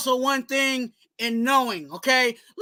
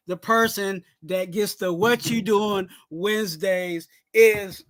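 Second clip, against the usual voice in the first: ordinary delivery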